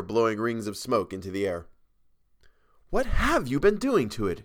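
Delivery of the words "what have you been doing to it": higher, alarmed exclamation; exclaiming. A narrator's reading. Model sees the spoiled canvas, higher exclaiming. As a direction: cry out at the ruined picture. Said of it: "she exclaimed".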